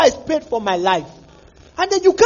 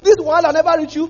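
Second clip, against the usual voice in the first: quick, louder pidgin tease; teasing questioner; 5 semitones higher, 6 dB louder, faster than usual. Pretend. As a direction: needle him about the trouble.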